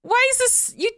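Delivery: high-pitched, flabbergasted retelling; exasperated, pitch held way up; incredulous protest. Retold or imitated. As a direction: protest in comic disbelief. Retold incredulous protest at being judged.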